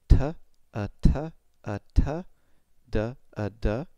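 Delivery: "T, a, t" and "d, a, d" as crisp separate syllables; tongue-tip drill. Shown as a demonstration. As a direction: tap "t" and "d" with only the tongue tip. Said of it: "same for T or D".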